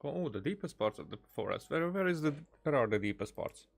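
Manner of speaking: normal talking voice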